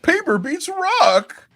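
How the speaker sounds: high, loud, drawn-out mock cry; hammed-up high-pitched proclamation; triumphant Mark Sterling. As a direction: declare it slowly in a squealing put-on voice. Imitating Sterling's triumphant retort.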